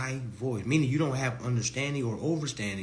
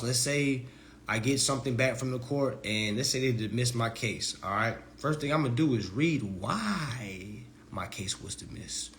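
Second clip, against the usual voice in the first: ordinary talk, nothing marked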